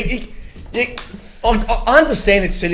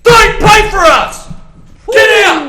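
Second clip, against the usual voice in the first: fired-up rant; 8 semitones higher than usual. Real, not acted. Crows fan shouting at departing player, angry. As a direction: tell him to get out, shouting.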